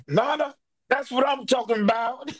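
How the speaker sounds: excited greeting. Retold or imitated. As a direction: cheer him on like an old buddy. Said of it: "He was like".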